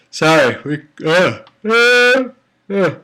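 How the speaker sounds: words stretched through a yawn. Real, not acted. Reader apologizing mid-yawn, words through yawn. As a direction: talk right through a big yawn.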